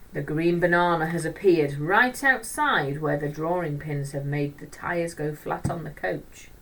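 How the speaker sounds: plain reading voice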